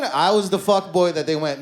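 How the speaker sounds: usual speaking voice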